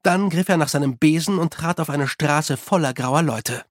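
usual speaking voice

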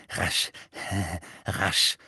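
tiefes, keuchendes Murmeln; pfeifend gemurmelt. A narrator's reading. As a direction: mit pfeifendem Atem vor sich hin murmeln. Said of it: "eine pfeifende Stimme direkt vor der Bürotür murmeln". (pfeifende, murmelnde Stimme)

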